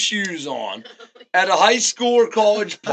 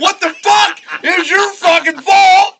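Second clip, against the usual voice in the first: high, raised voice imitating an angry parent; mock angry yelling; 9 semitones higher, 5 dB louder than usual. Parents yelling at teen over a wreck, imitated anger. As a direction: scold in mock outrage. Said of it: "They're yelling at you for getting in a wreck".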